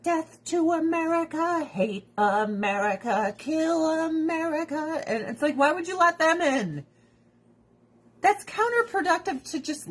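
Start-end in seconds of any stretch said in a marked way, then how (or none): 0.0-5.0 slow, droning mock chant; put-on menacing chant; mock America-haters. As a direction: chant it like a caricatured zealot. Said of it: "people that are like".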